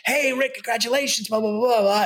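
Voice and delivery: high-pitched imitation of well-wishers; gushing congratulations. Folks congratulating Rick at the Emmys, mock gushing. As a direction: hail him excitedly, trailing into blah-blah.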